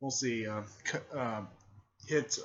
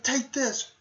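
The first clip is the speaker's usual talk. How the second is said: very high, loud battle cry; shouted attack call; 13 semitones higher, 8 dB louder than usual. Retold or imitated. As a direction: hurl it out as an attack. Character calls out an attack.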